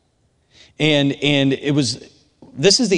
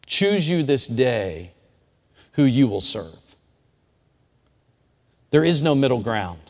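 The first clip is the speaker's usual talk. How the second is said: solemn preaching; slower than usual. Acted. Slow solemn sermon challenge.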